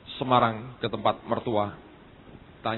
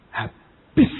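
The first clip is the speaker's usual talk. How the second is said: loud, drawn-out mimicked bad news; punchy impersonation of an eager messenger; imitating friend's gusto; 5 semitones lower, 6 dB louder than usual. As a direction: copy his emphatic delivery, stretching the word. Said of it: "Saya nirukan".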